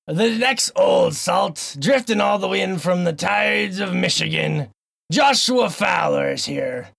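slow, grand pirate announcer; an old captain introducing his crew. Acted. Host in pirate voice introducing the next guest. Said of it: "this stupid voice".

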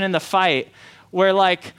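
normal talking voice

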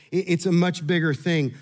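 ordinary delivery

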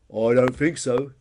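loud, slow, smug denial; bonehead dinosaur Peter. A narrator's reading. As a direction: draw it out loudly. Peter rejects the answer.

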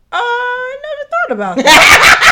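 high laughing reply, caught off guard; high and loud, laughing, caught off guard; laughing surprise. Retold or imitated. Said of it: "he was like".

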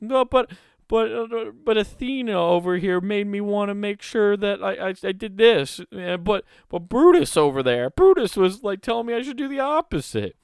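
high, fast, flustered excuses; flustered worshipper. Pretend. Voicing a flustered Egyptian worshipper.

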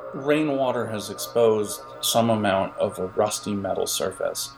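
usual speaking voice

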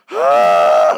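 very loud, drawn-out mock bawl; imitated sobbing wail. Father imitates daughter crumbling on log ride, loud wail. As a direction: imitate a child bursting into sobs. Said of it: "like heaving sobs".

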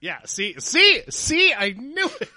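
high, loud gotcha; triumphant. Real, not acted. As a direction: crow about being right. Triumphant gloating.

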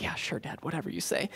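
very soft, half-whispered mock agreement; under-the-breath, unconvinced go-along; dismissive humouring. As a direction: imitate kids quietly placating their dad. Kids humouring their grouchy dad.

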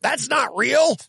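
mocking a friend's complaint with a high yell; mocking the critic. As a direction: shout it like a fussy nitpicker. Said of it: "You're like".

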